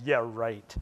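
low, dismissive mock agreement; dry sarcasm. God answering the boastful sunrise watcher, dryly sarcastic. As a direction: drop it low, unimpressed by the boast.

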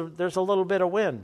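usual speaking voice

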